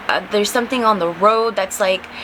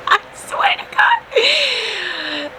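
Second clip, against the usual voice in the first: strained, drawn-out whisper; breathless whispered oath; 11 semitones higher, much slower than usual. Real, not acted. Storyteller insisting the border story is true, whispered vow. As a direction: squeeze it out slowly with no voice.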